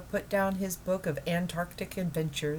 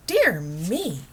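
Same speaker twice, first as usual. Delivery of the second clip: mildly shocked, a bit louder; a touch of prim shock; wife mildly scandalized by penguins; 8 dB louder than usual. Acted. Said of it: "in a shocked tone".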